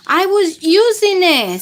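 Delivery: high loud mock scream; storybook child screaming. A narrator's reading. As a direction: cry out that it was yours. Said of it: "screams Ali".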